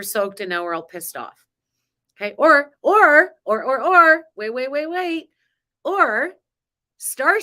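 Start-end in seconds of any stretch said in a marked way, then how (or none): 2.2-7.4 higher and much louder, tumbling over her own words; excited interruption. Real, not acted. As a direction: grab the idea before it escapes. Bursts in with a new idea.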